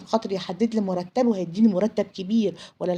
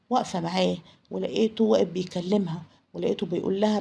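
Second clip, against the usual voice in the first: ordinary delivery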